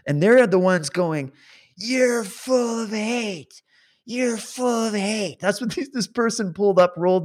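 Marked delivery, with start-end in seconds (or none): 1.8-5.4 high, slow, drawn-out mock yell; put-on indignant heckler voice; mocking an angry heckler. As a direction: imitate a heckler, stretching every word high. Imitating angry passerby shouting slogan.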